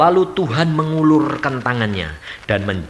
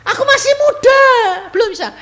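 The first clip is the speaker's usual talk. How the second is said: put-on much higher, whiny protest of a reluctant young prophet; reluctant Jeremiah; 5 dB louder, faster than usual. Jeremiah protesting to God that he is too young, high whine. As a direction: whine like a boy ducking the job. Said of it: "Dia bilang apa?".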